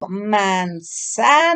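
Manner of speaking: "Manzana" very slow, syllable by syllable; slow read-along Manzana; sounding out syllables. Shown as a demonstration. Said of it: "lee conmigo".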